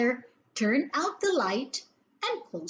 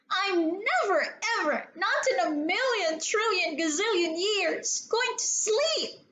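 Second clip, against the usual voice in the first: put-on childish defiance; 8 semitones higher than usual. A narrator's reading. Defiant child refuses sleep.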